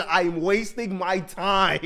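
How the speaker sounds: ordinary talk, nothing marked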